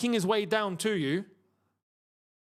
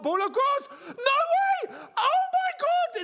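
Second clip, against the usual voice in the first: excited shouting; 4 dB louder, slower than usual. Real, not acted. A goal chance in the live match.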